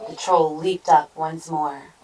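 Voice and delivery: ordinary delivery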